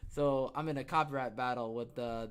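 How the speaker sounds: usual speaking voice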